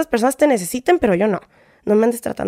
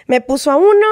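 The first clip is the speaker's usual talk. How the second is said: usual speaking voice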